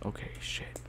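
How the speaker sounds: hushed frightened whisper; breathless hushed panic; scared whisper. Real, not acted. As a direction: whisper so nothing hears you. Whispering in fear.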